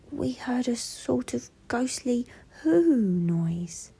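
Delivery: high, slow, hushed little bear; Little Bear's voice. A narrator's reading. Little Bear peeping from behind Old Bear, high and hushed. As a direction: whisper it high like a timid small bear. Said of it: "said Little Bear".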